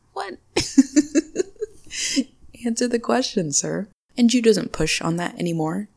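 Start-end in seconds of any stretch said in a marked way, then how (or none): 0.5-1.6 high bright laugh bursting out; burst of laughter. Real, not acted. Host laughing at her own joke, high laugh.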